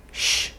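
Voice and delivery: sh made only with the mouth; som não vozeado. Shown as a demonstration. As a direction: let out a voiceless sh. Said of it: "você faz esse som com a boca". (sh só com a boca)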